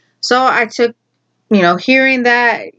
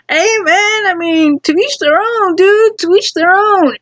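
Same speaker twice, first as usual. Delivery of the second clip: high sing-song mock tolerance; mock magnanimity; 10 semitones higher than usual. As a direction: pretend to be generous, rubbing it in. Teasing, feigned tolerance.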